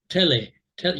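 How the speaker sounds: "tele" said te-LE, full e in the second syllable; Spanish-style stress. Shown as a demonstration. Teacher contrasting Spanish stress with English. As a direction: say "tele" as a Spanish speaker would. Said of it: "that would be second syllable stress".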